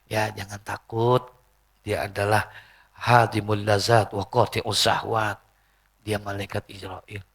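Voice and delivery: low, slow, soothing answer; calm reassurance. Retold or imitated. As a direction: gently settle a frightened daughter. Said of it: "diam, senyum". (menenangkan dengan suara rendah dan pelan)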